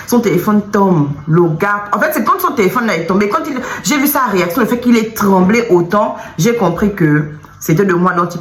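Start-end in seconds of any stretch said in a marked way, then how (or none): none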